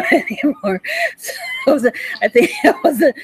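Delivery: words squeezed out through laughter; laughing. Real, not acted. Still laughing over the radio show, talking through giggles. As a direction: keep giggling as you talk.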